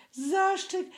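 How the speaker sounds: very high thin squeak; high piping echo from a scared piglet. A narrator's reading. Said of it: "zawtórował cienkim głosem Prosiaczek".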